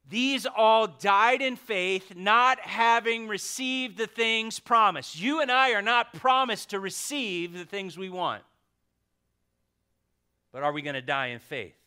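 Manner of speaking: fervent pulpit reading; impassioned scripture reading. Real, not acted. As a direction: read it out like a herald. Reads scripture loud and high.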